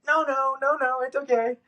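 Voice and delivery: smother the irritation with high politeness; forced cheerful "no, no". Retold or imitated. To the staff after forty-five minutes of waiting, a high, strained "it's okay". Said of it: "But I went".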